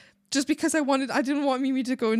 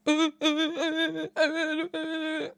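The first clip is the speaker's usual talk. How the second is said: slow, higher wordless whining noise; mocking whiner; 4 semitones higher, much slower than usual. Imitating the annoying guest's complaints, mocking whine. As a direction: drone a mocking whine in their place. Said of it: "deal with them being like".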